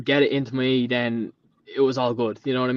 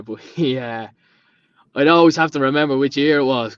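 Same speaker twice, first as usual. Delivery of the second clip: ordinary talk, nothing marked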